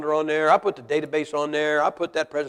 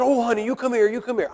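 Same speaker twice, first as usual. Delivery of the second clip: soothing mom; 6 semitones higher than usual. Retold or imitated. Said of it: "And Mom says".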